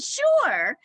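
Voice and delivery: high, loud eager yes; eager people pleaser. Retold or imitated. Host recalling agreeing to run a summit, eager yes. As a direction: say yes brightly before thinking. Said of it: "I'm such a people pleaser".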